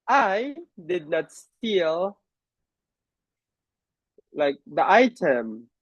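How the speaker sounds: "I" stressed as the key word. Shown as a demonstration. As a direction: put the weight on "I", then slow deliberate words. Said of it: "stress a word, it creates a different meaning".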